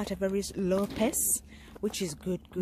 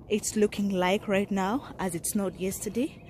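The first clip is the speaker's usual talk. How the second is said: ordinary talk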